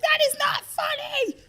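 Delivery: very high, loud, slow mock outrage; hammed-up scolding screech; imitating freaked-out teacher. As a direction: shriek it like a scandalised teacher. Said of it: "freaked the fuck out".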